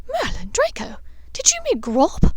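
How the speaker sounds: can't believe what she's hearing; incredulous. A narrator's reading. Hermione shocked that Draco met the giant, high incredulous. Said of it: "she asked incredulously".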